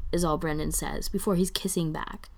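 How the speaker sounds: usual speaking voice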